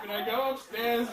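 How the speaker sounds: normal talking voice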